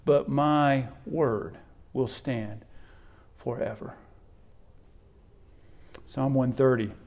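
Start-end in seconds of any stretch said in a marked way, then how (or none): 0.0-3.9 slow solemn. Acted.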